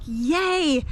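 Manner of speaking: voicing delight at success; excited cheer. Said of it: "I'm so excited because it's like".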